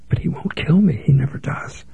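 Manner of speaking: fearful hushed whisper; frightened whisper to herself. A narrator's reading. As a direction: breathe it out, barely voiced. Lydia whispering her fear.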